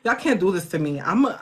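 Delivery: usual speaking voice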